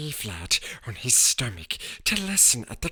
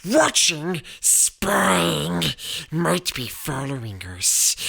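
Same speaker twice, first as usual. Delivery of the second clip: wild mutter; 5 semitones higher, slower than usual. A narrator's reading. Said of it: "muttered Uncle Vernon wildly".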